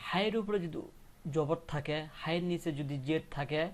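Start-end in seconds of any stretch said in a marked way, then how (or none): none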